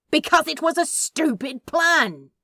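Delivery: exasperated shout. Acted. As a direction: shout him down. Crowley yells in the quarrel.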